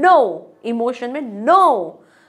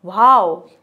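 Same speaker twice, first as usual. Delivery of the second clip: louder drawn wow; amazed wow; 4 semitones lower, 5 dB louder, much slower than usual. Shown as a demonstration. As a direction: marvel at it. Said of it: "आश्चर्य में".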